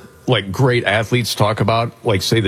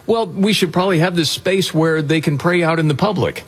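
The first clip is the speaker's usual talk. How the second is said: higher-pitched, lightly voiced proposer; mock well-meaning advocate; 7 semitones higher than usual. Host voicing someone proposing a prayer space, mock well-meaning. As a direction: pitch it up like a helpful suggestion.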